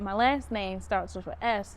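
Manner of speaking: ordinary talk, nothing marked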